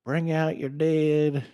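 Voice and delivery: slow, drawn-out call of a plague cart man; a slow Monty Python cart call; corpse collector's call. Retold or imitated. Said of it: "Like, br".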